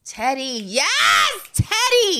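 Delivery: loud, rising cheer for the poet; cheering shout. Pretend. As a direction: whoop him on like a fan. Cheering the poet's reading.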